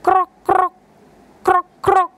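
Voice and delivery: high playful croaking; frog croak imitation. Shown as a demonstration. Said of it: "it says".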